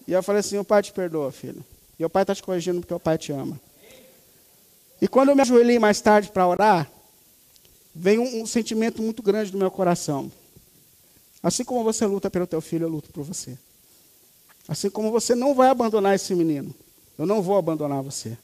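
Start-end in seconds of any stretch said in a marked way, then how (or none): 0.6-3.6 put-on quiet, gentle reassurance to a child; soft forgiveness. Father forgiving his crying son, quiet and tender. 11.4-18.4 softer, hushed divine reassurance; quiet inner voice. Retold or imitated. God speaking to the praying father, soft and tender.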